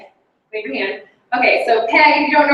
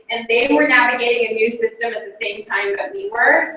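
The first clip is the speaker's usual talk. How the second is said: ordinary talk, nothing marked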